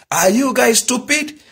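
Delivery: put-on high-pitched child's question, rising at the end; imitating daughter's question. Daughter questioning her shouting father, high mimicked asking. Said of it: "So she asked".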